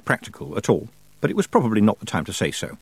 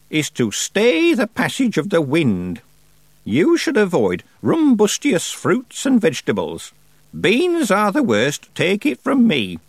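put-on raised, deliberate voice of an imperious old witch; old witch Miss Treason; 9 semitones higher, 4 dB louder, slower than usual. Old witch advising young Tiffany, imperious and slow. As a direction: play the grand old witch holding court.

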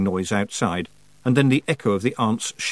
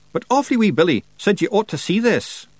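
high-pitched dialect character; Rob Anybody; 10 semitones higher than usual. Acted. Rob urges Tiffany to look, high dialect voice. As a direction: speak up high in a lilting dialect.

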